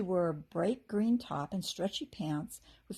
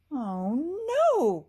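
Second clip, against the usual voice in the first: high, loud alarmed cry; 7 semitones higher, 7 dB louder than usual. A narrator's reading. Kirsty seeing the fairy trail vanish, crying out. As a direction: cry out in dismay. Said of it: "Kirsty cried".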